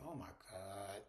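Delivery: low, soft retold "oh my God"; hushed dismay. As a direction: imitate a quietly dismayed reaction, low in pitch. Recapping a shocked morning-after reaction.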